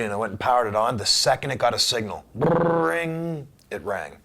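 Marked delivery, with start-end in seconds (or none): 2.3-3.5 high trilled phone ring; imitated phone ring. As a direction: roll out a long, high "brrring". Imitates his phone ringing.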